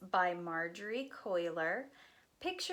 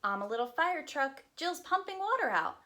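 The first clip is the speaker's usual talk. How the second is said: lively, sing-song picture book reading; reading to children; 6 semitones higher, 4 dB louder than usual. Acted. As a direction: read it aloud to a group of little kids.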